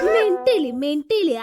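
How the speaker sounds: loud, then dropping in pitch; exasperated scolding. Acted. Visitor scolds whoever set the girl crying, exasperated. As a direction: scold in two short, falling bursts.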